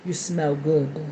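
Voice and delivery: put-on low, soft boyfriend voice; imitating her boyfriend. Her man sniffing her up close, low murmur. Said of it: "He be like".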